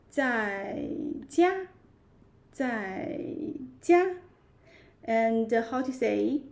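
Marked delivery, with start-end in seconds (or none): 1.3-1.7 家 held high and level, a bit louder. Shown as a demonstration. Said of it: "We can say". 3.8-4.2 家 held high and level, a bit louder. Shown as a demonstration. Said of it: "We can say".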